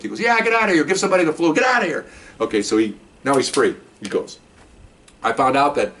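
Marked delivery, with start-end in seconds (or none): 0.2-2.1 raised, shooing boss voice; sergeant shooing. Retold or imitated. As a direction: wave him off like a sergeant. Sergeant shooing him home.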